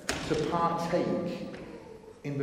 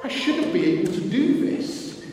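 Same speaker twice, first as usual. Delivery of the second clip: higher and louder, bursting with glee; thrilled disbelief; 9 semitones higher, 5 dB louder than usual. Retold or imitated. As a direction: blurt it out amazed at yourself. Peter thrilled on the water.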